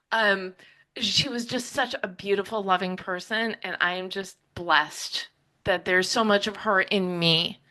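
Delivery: normal talking voice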